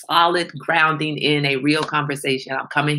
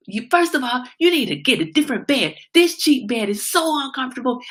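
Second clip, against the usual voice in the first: put-on higher, louder exasperated scolding of a snoring boyfriend; mock angry rant; 8 semitones higher, 3 dB louder than usual. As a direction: scold him like you're fed up, half-joking. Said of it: "I was like".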